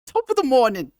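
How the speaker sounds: high falsetto stage-Irish greeting; falsetto Irish accent. Shown as a demonstration. Streamer practising Irish greeting for chat, high falsetto brogue. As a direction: chirp it like a leprechaun in Irish brogue. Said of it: "sounds like I'm talking in a little falsetto".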